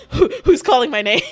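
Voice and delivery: loud, stammered re-enacted question with laughter; mock-alarmed, half laughing; startled. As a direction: act out comic bewilderment. Re-enacting being startled by her name.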